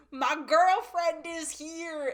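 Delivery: loud, high, drawn-out announcement; imitated scream. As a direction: holler it slowly to the whole room, imitating him. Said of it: "he just screams".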